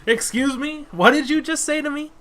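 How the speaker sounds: high, loud mock outrage; mock offended. Player feigns outrage at the fishmonger's words.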